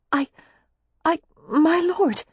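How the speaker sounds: high, slow, halting stammer; stuttering surprise. A narrator's reading. Daisy startled by his declaration, stuttering in surprise. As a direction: falter in astonishment before getting it out. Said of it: "could only stutter in surprise".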